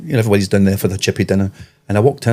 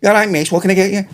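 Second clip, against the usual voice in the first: higher-pitched imitation Scouse woman; Scouse accent; 9 semitones higher, faster than usual. Chippy girl greeting a Scottish customer, higher Scouse accent. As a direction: put on a Scouse counter girl. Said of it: "And whatever accent it is".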